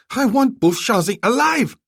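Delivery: shouted order. Acted.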